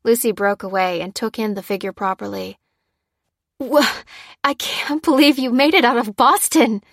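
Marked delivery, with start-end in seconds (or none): 3.6-6.8 high, loud astonishment; falter, then burst out amazed; thrilled disbelief at seeing a friend; stammering amazement. A narrator's reading. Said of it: "she stammered with amazement".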